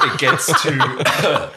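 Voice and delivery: slowed words through real laughter; laughing. Real, not acted. Host laughing mid-sentence, slow and giggly. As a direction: laugh while getting the words out.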